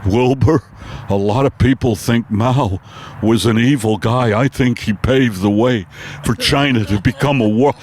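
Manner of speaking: a dazed horse struggling to breathe; Mister Ed on acid. Acted. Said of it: "my impersonation of Mister Ed".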